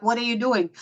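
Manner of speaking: normal talking voice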